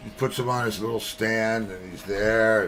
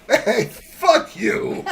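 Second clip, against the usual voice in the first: parrot cursing; 10 semitones higher, 3 dB louder, much slower than usual. Acted.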